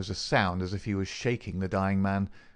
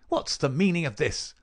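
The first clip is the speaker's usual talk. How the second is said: higher-pitched, dry demand; dry, rasping; 7 semitones higher, 3 dB louder than usual. Acted. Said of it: "in a dry, rasping tone".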